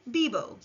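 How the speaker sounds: "bibo" with b sounds, falling; model Spanish verb. Shown as a demonstration. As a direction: say "bibo" clearly for learners. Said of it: "Repeat after me".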